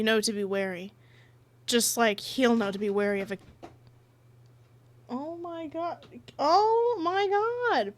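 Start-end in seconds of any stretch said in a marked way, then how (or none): 5.1-7.9 long-drawn high "oh my god"; exasperated. Real, not acted. Exasperated reaction to someone rushing past.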